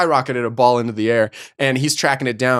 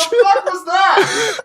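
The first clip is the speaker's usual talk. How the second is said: much higher, slow, drawn-out yell; mock coach yell; much slower than usual. Coach yelling at a player's botched easy play. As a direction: stretch it out in disbelief, high. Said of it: "I'm just like".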